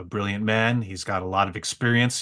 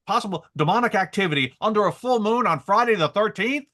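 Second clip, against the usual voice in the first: imitated scolding; 8 semitones higher than usual. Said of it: "I'm getting yelled at out here".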